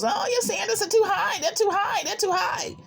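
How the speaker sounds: high mock of nagging women; high mocking sing-song; mocking other women.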